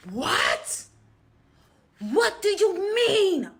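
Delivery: shouted, pitched far up and slow on the second line; screaming on the phone. Retold or imitated. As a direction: yell it down the phone in disbelief. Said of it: "starts screaming on the phone".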